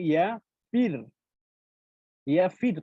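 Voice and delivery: ফির said with a thin, light r; thin r demo. Shown as a demonstration. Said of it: "তো তখন আমরা পাতলা করতেছি".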